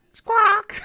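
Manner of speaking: high laughing parrot squawk. Pretend. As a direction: screech like a cartoon parrot. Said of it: "Flintstones, like, parrot".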